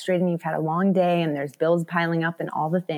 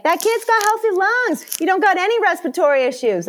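mock cheerful; 12 semitones higher, 5 dB louder than usual. Traveler praising a screaming kid's lungs, much higher, beaming. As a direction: sell it like happy good news.

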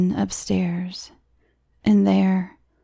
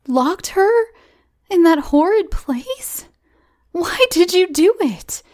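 much higher, shrill-edged reproach; indignant; 12 semitones higher, 3 dB louder than usual. Acted. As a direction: scold him in disbelief. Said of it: "exclaimed Cora indignantly".

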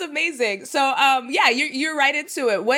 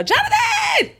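excited welcome shout; slower than usual. Acted. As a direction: call the guest in like a game show host. Host welcomes guest enthusiastically.

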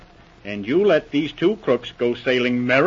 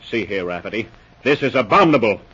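normal talking voice

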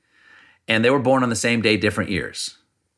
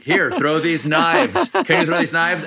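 Russian billionaire; 7 semitones higher, slower than usual. Retold or imitated. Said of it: "And he was like".

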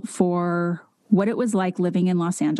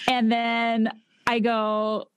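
ordinary delivery

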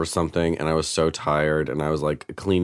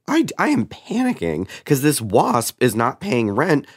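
fast, higher panicked rush; 7 semitones higher than usual. Retold or imitated. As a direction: blurt it out in a panic. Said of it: "I was like".

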